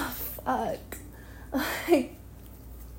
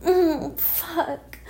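normal talking voice

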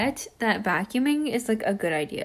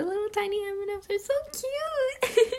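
cutesy gushing; 11 semitones higher, faster than usual. Real, not acted. Gushing over tiny M and Ms.

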